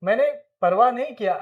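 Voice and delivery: normal talking voice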